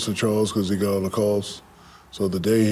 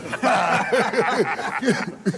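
high bursting laugh; 11 semitones higher than usual. Real, not acted. Laughing after the spades quote.

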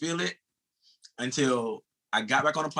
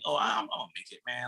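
softer, breezy self-assurance; casual; 5 dB quieter than usual. Retold or imitated. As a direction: shrug it off as a sure thing. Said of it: "used to say it so casually".

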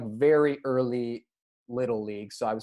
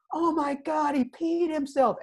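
put-on much higher taunting kid voice; mocking teammates; 13 semitones higher than usual. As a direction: tease like a schoolyard crowd.

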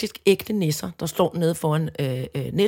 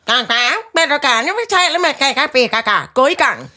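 imitated talking till, pitched up; self-checkout machine; 4 semitones higher, 3 dB louder than usual. Self-checkout prompting a customer to pay, mock machine voice. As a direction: play a pushy automated checkout. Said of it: "så siger den".

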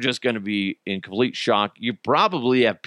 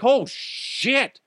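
yelling at screen; 11 semitones higher than usual. Retold or imitated. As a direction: shout warnings at the movie. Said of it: "be like".

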